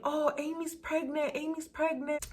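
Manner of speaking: sing-song imitation of school gossip; mock gossip.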